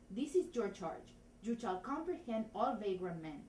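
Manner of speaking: put-on lines in a thick Spanish accent; measured Spanish-accented delivery. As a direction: play the constable with a comic Spanish accent. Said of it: "try it in Spanish".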